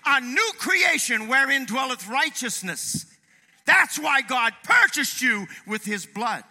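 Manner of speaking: loud, high, fervent preacher; full-force preaching. Real, not acted. Preacher challenging congregation over Jesus's sacrifice, fervent. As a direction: thunder it at the congregation.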